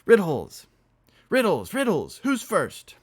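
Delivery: high, loud repeated calls; excited shouting. Acted. Heavy one shouting to the room.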